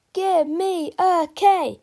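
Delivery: loud, high, drawn-out cheerleader chant; cheer shout. Acted. Cheerleaders call to the crowd, loud drawn-out cheer. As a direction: belt it to the stands like a cheer. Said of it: "shouted the cheerleaders".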